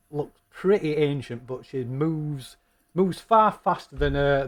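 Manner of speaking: normal talking voice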